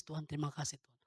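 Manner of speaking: soft, low recalled prayer; retold tearful prayer. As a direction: murmur thanks to God, as if remembering tears. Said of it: "saya tuh nangis". (menirukan doa haru dengan suara pelan)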